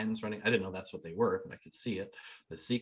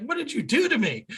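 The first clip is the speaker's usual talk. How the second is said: much higher, louder astonished cry; stunned disbelief; 14 semitones higher, 6 dB louder than usual. Retold or imitated. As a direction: blurt it out, flabbergasted. Skeptic stunned by healing.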